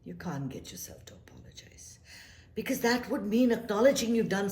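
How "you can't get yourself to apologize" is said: soft whispered repeat; a hushed whisper to the listener. Real, not acted. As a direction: whisper the point home. Reflective repeat.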